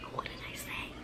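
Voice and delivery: barely audible, whispered question; stunned whisper. Retold or imitated. Girl just accused by her best friend, stunned whisper. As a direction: whisper it in disbelief. Acts out being confronted, two takes.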